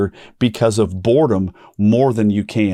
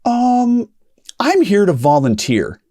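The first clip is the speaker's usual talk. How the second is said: timid reply; 10 semitones higher than usual. Retold or imitated. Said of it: "And she was like".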